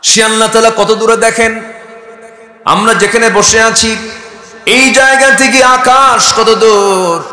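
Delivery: high, loud sustained proclamation; full-force preaching. Acted. Preacher proclaiming God's creation to the crowd, high and loud. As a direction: raise the voice and proclaim it.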